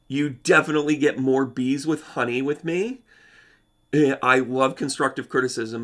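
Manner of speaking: normal talking voice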